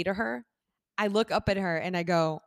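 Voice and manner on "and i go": ordinary talk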